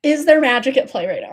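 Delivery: usual speaking voice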